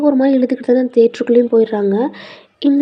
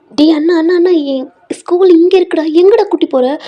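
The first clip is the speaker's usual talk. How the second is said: loud, higher, alarmed calling out; yelling at brother; 6 semitones higher, 5 dB louder than usual. Acted. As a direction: shout at him to stop the bike. Sister yells at distracted brother. (அண்ணனை நோக்கி உரத்த கூச்சல்)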